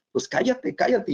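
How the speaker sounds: high-pitched mock telling-off; imitated hushing. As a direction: act out irritated disciples shutting someone up. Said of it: "le decían a esta mujer".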